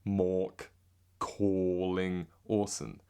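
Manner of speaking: Mork, calling, Orson all drawn out on "or", no r; British OR vowels. Shown as a demonstration. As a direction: stretch the same round "or" in "Mork calling Orson". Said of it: "It's all or".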